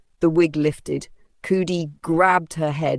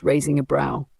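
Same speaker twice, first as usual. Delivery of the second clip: ordinary delivery, nothing marked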